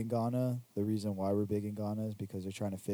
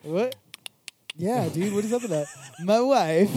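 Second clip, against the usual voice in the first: excited; 8 semitones higher, 7 dB louder than usual. Acted. Mock outrage at Ghana joke.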